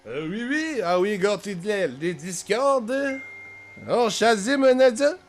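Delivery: high, hammed-up French accent; mock French accent. Streamer joining Discord in a joking French accent. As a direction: put on a silly French accent. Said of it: "I don't know why I'm speaking French still".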